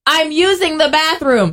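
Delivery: high, slow call through the door. Acted. Occupant answering a bathroom knock, calling out slowly. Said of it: "here are the popular responses for how you should respond".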